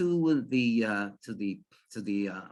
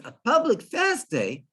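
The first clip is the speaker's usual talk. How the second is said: startled, higher-pitched echo question; disturbed; 7 semitones higher, 3 dB louder than usual. Retold or imitated. Farmer whose calm was disturbed, echoing in alarm. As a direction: repeat it in mock alarm. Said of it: "all of a sudden disturbed".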